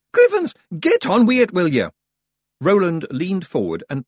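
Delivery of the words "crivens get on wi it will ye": high, loud Scots pixie; exasperated wee Feegle; impatient Feegle. Acted. Rob Anybody loses patience with Roland, loud.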